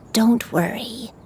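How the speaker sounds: ordinary delivery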